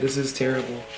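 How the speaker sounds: soft despairing murmur, fading away; hushed dismay. Real, not acted. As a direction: mutter it to yourself in quiet despair. Plants leaning over, scared.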